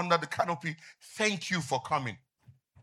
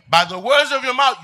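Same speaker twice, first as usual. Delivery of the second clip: higher, louder repeat; emphatic preaching; 8 semitones higher, 11 dB louder than usual. Acted. Pastor driving the verse home to the church, louder. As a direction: hammer the line home.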